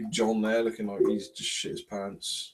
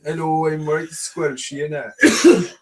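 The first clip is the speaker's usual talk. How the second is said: slow, raised, squelchy mock Welsh; squelchy Welsh accent; 4 semitones higher, 10 dB louder, slower than usual. Playing on the squelch joke, squelchy Welsh accent. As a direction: ham up a soggy Welsh accent. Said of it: "Squelch accent".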